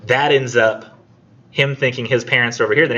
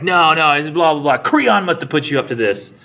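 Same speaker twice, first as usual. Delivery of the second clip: dismissive outburst; 6 semitones higher than usual. Retold or imitated. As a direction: wave him away angrily. Oedipus dismisses Tiresias.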